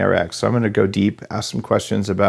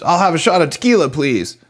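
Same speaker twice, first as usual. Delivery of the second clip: put-on Texas accent; 8 semitones higher, 4 dB louder than usual. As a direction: play a boastful Texan ordering at the bar. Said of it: "he says it in even more of a Texas accent".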